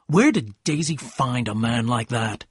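plain reading voice

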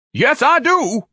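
high, loud shrieked retort; scream. A narrator's reading. Ignatius screams at the offended painter. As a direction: scream it back at them. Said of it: "Ignatius screamed".

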